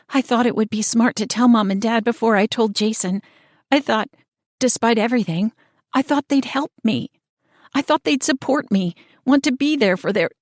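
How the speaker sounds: the tears creep in as she goes; near tears. Acted. Pregnant sister telling of parents' betrayal, near tears. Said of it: "she begins to cry again".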